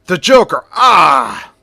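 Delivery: startled shout of alarm; guard's scream. Acted. Police guard shouting at the Joker in alarm. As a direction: shout the name and cry out in shock. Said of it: "One of the police guards scream".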